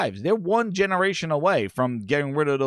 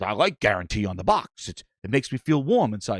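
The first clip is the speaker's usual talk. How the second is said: deep dopey voice, lower pitch; Tommy Boy customer; 8 semitones lower than usual. Retold or imitated. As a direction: drop low and play the gullible buyer. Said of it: "the scene from Tommy Boy".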